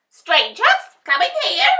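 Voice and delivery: very high, loud screech; witch screech. A narrator's reading. Witch screeches for her wolves, high and loud. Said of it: "she screeched".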